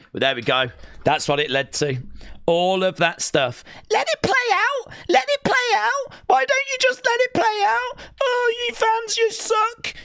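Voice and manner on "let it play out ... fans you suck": very high, nasal-free mock whine of the crowd; mocking whiny fan. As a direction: sneer it up high like a petulant fan. Mocking fans who cheered chants.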